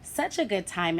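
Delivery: usual speaking voice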